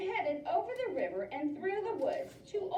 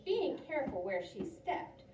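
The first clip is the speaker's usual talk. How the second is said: ordinary delivery, nothing marked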